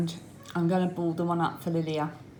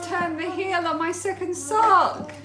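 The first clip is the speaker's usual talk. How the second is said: excited; 13 semitones higher, 6 dB louder than usual. Real, not acted. Celebratory announcement.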